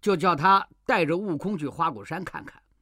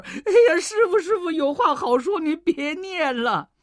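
high pleading monkey cry, much higher; Wukong in pain; 10 semitones higher than usual. A narrator's reading. Monkey king in pain begs master, high pleading cry. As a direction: beg the master to stop, voice up high. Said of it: "悟空疼得满地打滚儿".

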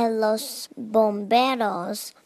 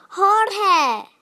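high, loud call of a name; 9 semitones higher, 5 dB louder than usual. A narrator's reading. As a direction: call him from across the room. Man calls out the monkey's name.

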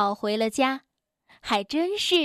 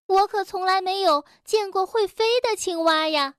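mildly skeptical, higher; a bit doubtful; 6 semitones higher than usual. A narrator's reading. Rabbit doubting that frogs can fly, skeptical. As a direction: voice a gentle doubt. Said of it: "蹦蹦有点怀疑".

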